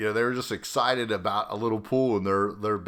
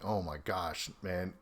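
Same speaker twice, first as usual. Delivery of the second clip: much softer, sinking murmur; hushed "oh no" to himself; dismayed aside; 2 semitones lower, 10 dB quieter than usual. Retold or imitated. Bystander realizing trouble, soft dismayed murmur. Narrator's own dismayed inner reaction.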